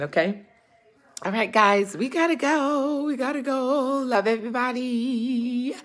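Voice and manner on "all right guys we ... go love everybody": put-on high, slow sing-song English accent. Saying goodbye to viewers, lilting English accent. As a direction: sign off in a playful English accent. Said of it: "we're working on our English accent".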